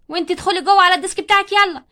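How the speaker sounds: loud, bossy teacher command; stern order. Acted. Teacher orders a pupil back to her desk, loud and stern.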